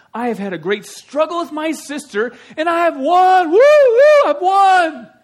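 triumphant, building to high whoops; hammed-up loud victory cry; Rachel. Rachel crowing over her struggle with her sister, triumphant shouting. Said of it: "Then Rachel said".

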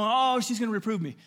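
high, whiny mock dread; dramatic inner groan of protest; screaming flesh. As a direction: complain it high-pitched. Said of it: "my flesh can be screaming".

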